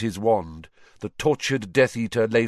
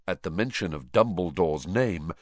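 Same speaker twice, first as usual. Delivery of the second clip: plain reading voice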